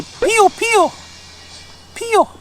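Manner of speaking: very high peeping Pio, pio, pio; chick peep. Shown as a demonstration. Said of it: "They say".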